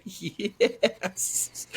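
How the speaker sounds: high, squeaky laughing yes; laughing confirmation. Retold or imitated. Retelling his answer to horrified wife, high giggling glee. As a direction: squeeze it out through laughter.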